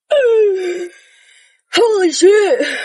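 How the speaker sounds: high, breaking exclamation of relief after a sigh; relieved exclamation. Retold or imitated. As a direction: let it all out at once, cracking up high. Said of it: "And I was just like".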